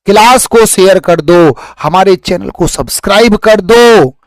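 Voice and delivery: teacher loudly urging students to share and subscribe; loud appeal. Acted. Loud high appeal.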